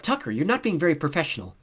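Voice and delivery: put-on high, loud girlish reproach; complaining. A narrator's reading. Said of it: "Simone complained".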